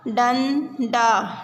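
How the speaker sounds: डंडा drawn out very slowly, syllable by syllable; slow syllable reading. Shown as a demonstration. Teacher sounds out डंडा for children, very slowly. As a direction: stretch out डंडा for the class to follow. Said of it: "शब्दों को पढ़ेंगे".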